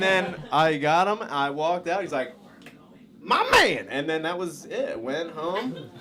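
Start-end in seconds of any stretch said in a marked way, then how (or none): 3.2-3.9 high, loud hyped cheer; hammed-up delighted clerk; super-pumped clerk. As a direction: shout it up high like a hype man. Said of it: "He was super pumped".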